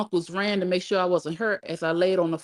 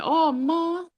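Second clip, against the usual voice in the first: high, drawn-out plea; pleading daughter; 11 semitones higher than usual. A narrator's reading. Daughter starting another excuse to her mother, pleading. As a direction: wail a protest up high.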